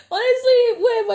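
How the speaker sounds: loud, high, laughing through tears; laughing and teary. Real, not acted. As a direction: laugh while half crying. Moved by friend's letter.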